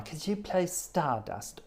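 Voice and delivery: soft, slow imitation of a punter; hushed, drawn-out mock request; audience requester voice. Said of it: "they always say it in that voice".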